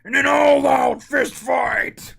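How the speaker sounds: slow booming hype shout; monster truck voice. Acted. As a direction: roar it like a truck rally ad. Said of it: "the monster truck voice".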